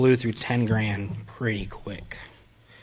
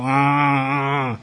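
long drawn-out mock "aah"; imitated dazed moan; 3 semitones higher, 4 dB louder than usual. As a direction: imitate rocking and moaning, held long. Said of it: "rock and go".

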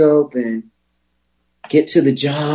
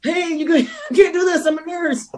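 high, pleading mock drunk whine; hammed-up drunk begging the cops; drunk protest. Drunk nurse pleading with the police, high mock whine. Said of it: "Why don't you yell this a few times".

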